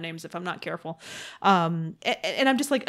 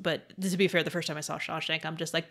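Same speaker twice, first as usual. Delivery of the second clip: normal talking voice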